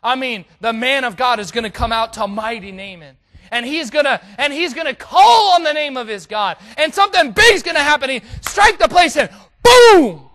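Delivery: hammed-up high shout building to an explosive boom; grandiose imagining. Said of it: "Naaman had all in his mind how this thing was gonna play out".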